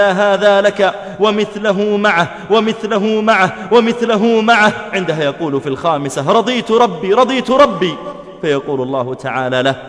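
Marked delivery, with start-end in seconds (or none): 0.0-4.7 high, raised voice, insistent repetition; emphatic proclamation. Acted. Preacher voicing God's repeated grant to His servant, emphatic proclamation. 6.3-7.9 high-pitched, repeated exclamation; eager acceptance. Acted. Preacher voicing the servant accepting God's gift, eager acceptance.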